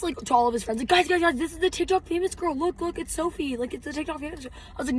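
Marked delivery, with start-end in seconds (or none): 0.9-4.5 high-pitched mock fanboy gushing; imitated excited fan. Guy telling friends about a famous girl, high excited imitation. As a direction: mimic someone urgently showing off a celebrity sighting.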